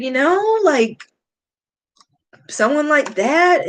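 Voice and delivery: usual speaking voice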